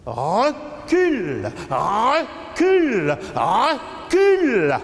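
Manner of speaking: slow repeated order, rising in intensity; building command. Acted. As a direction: stretch each word and swoop up, building. Repeated command building within poem.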